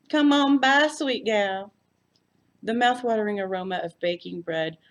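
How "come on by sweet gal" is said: loud, high, drawn-out South Texas welcome; deep South Texas accent. A narrator's reading. Floy welcoming her visitor in a South Texas accent. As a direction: greet her like a Texas hostess, loud and bright. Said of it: "in her deep South Texas accent".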